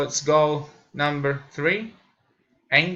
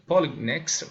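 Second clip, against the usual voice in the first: ordinary talk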